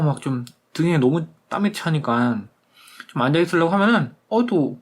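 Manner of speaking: ordinary delivery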